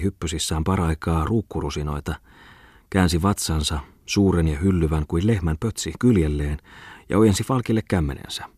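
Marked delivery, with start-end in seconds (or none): none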